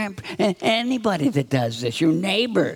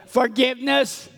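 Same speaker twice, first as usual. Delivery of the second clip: raised-pitch call for the group to join in; prompting repeat-after-me; 4 semitones higher than usual. Shown as a demonstration. Leader asks the children to help out, prompting call. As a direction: lead them in saying it, higher. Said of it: "Hey, help us out here".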